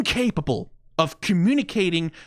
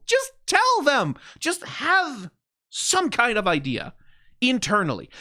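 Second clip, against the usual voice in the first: exasperated outburst; 6 semitones higher, slower than usual. Real, not acted. Ranting at Riot's corporate choices.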